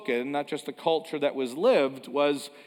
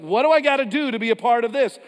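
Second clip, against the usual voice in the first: exasperated plea; 7 semitones higher, 7 dB louder, faster than usual. Retold or imitated. Voicing a frustrated outsider.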